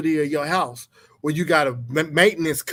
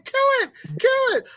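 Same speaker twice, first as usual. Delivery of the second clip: shrill, much higher kill it, kill it; mock scream. Husband mimics his wife screaming at a spider, shrill. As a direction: shriek it in mock terror. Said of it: "screaming and hollering".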